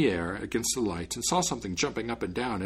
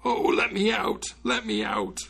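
high, slow, drawn-out pleading; pitiful cry; 11 semitones higher, slower than usual. A narrator's reading. Outwitted spirit begging the departing student, pitiful cry. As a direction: wail pitifully to be let go. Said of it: "the spirit cried out pitifully".

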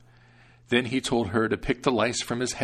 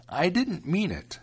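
put-on higher, slow, gentle old woman; old grandmother; 9 semitones higher than usual. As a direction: protective grandmother, unhurried. Grandmother apologizes.

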